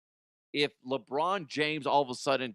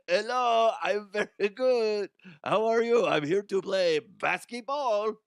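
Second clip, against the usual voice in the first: hammed-up French accent; 8 semitones higher, 6 dB louder than usual. LeBron introducing himself, loud mock French accent. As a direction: ham up a clumsy French accent. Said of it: "trying to do a French accent".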